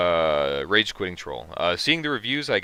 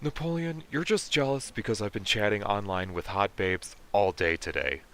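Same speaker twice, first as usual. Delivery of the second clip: lightly voiced Kip, thin and whiny; a hint of smug nerdy Kip; Kip impression; 5 dB quieter than usual. Streamer mocking Kip from Napoleon Dynamite, mildly whiny. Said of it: "does he sound like Kip from Napoleon Dynamite".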